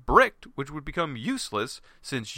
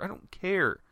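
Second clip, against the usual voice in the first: usual speaking voice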